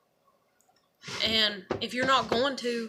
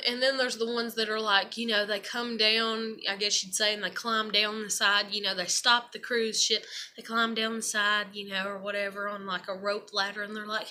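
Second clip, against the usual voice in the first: ordinary talk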